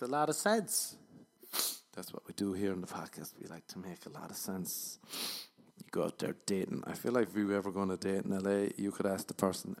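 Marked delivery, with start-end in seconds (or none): none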